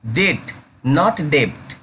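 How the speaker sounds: slow "डेट", then the wrong "डेप्ट" with a b sound; teacher slowly sets "डेट" against "डेप्ट"; debt versus wrong dept. Shown as a demonstration. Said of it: "डेप्ट नहीं पढ़ेंगे".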